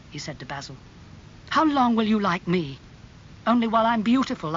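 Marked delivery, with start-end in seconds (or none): 1.5-4.6 heated reproach, louder and higher; acted hurt anger, raised voice; angry. A narrator's reading. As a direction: throw the accusation at a friend. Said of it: "his face red and angry".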